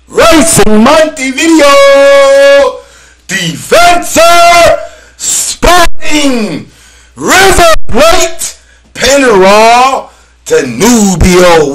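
high, loud, slow drawn-out calls; hype shout. Real, not acted. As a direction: shout each club like a goal call. Shouting Uruguayan teams.

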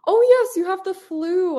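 surprised. Real, not acted. Suddenly remembers student is sick.

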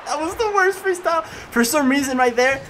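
high, amazed disbelief; can't believe how bad that was; incredulous. Real, not acted. Gamer reacting to his own failed freestyle, incredulous.

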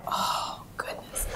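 a whispered inward groan; aghast under her breath; horrified whisper. Retold or imitated. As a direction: breathe it out in a hushed gasp of dismay. Dread at hearing ice cream truck.

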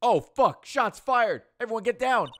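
high, loud alarm call; panicked shouting. Acted. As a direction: yell a warning, everyone duck. Mock panic at gunshot.

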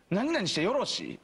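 ordinary talk, nothing marked

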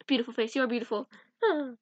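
usual speaking voice

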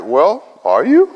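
slow, dry teasing question; drawn-out dry jab; deadpan tease. Retold or imitated. Teacher teasing the upset student, slow and dry.

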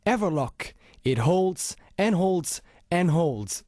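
slow, spaced-out ad tagline; slow slogan. Acted. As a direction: lean on each holds, slowly.

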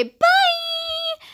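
a high, stretched-out goodbye call; squealed, high-pitched bye to the viewers. Real, not acted. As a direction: sing out the farewell way up high. Cheerful sign-off to viewers.

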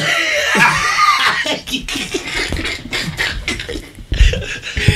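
high, wheezing burst of laughter; real belly laugh. Real, not acted. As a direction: crack up long and helplessly at the tease. Bursts out after being teased.

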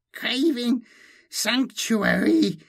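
high, slow inhuman supplicant; high distorted creature voice; Malindi, hissed. A narrator's reading. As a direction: hiss a drawn-out plea in a high alien voice. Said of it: "she hissed".

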